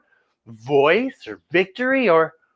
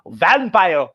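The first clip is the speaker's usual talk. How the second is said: normal talking voice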